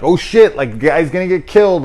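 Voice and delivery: high, loud startled gasp of a line; alarmed. Retold or imitated. Viewer startled by the kid in the back seat, loud alarm. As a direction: jump at the jump scare. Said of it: "you're like".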